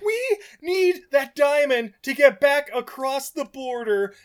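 high, insistent mock-outraged rant; exasperated rant. Podcaster ranting about getting the diamond across the border, exasperated. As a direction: plead the case in strained, high exasperation.

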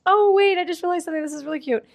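high, bright sudden discovery; gushing over a sweet detail; excited realization. Real, not acted.

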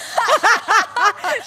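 loud, high cackling laugh; burst of laughter. Real, not acted. Laughing at own fitness-program plug, big real laugh.

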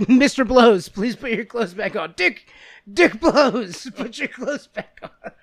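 high, loud, laughing mock officer. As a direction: play the cop while cracking up. Officer voiced through laughter.

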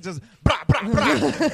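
loud, high mock gunfire; gunshot noises. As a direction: fire off mouth-made shots. Imitating gunfire in a story.

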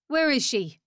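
loud nasal demand; nasal, barking question. A narrator's reading. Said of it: "The nasally voice was unmistakable".

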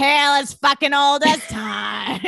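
slow, shouted parody singing; mock belted shout. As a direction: belt it out like a hammy diva. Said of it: "She's like".

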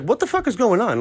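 high-pitched disbelief; retold confusion, pitched high; bewildered. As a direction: ask it baffled, voice jumping up. Bewildered by the crowd at work.

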